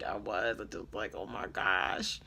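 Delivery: low, drawn-out groaning voice; mortified. Retold or imitated. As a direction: drop way down low in mock mortification. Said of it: "I was mortified".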